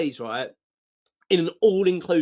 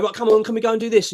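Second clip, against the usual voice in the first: put-on eager kids; 8 semitones higher, 7 dB louder than usual. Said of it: "the kids go".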